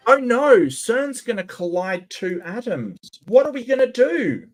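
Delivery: sarcastic high mock dread; mock panic. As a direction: fake terror over two atoms. Mocking doomsday claim.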